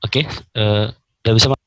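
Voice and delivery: choppy audio cutting out; bad connection. Real, not acted. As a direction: voice dropping in and out. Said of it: "Suaranya hilang-hilang".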